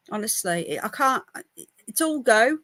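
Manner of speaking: ordinary talk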